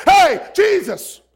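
slow, loud, high call; calling out to Jesus. Acted. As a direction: stretch the shout across the crowd. Blind man calling out to Jesus.

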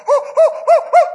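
high, loud breathless hoo hoo; high, loud breathless gasps as if drowning; high gasping hoots. Retold or imitated. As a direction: gasp for air in high hoots. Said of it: "that's exactly what I was doing".

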